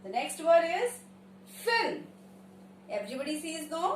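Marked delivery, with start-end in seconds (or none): 1.7-2.0 higher "film" in one syllable; correct pronunciation. Shown as a demonstration. Teacher announcing the next word, correctly pronounced. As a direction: say "film" with L and M joined. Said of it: "correct pronunciation film".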